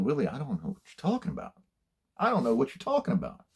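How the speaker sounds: retold bewilderment. Young officer answering a yelling boss, retold bewildered protest. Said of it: "and I was bewildered".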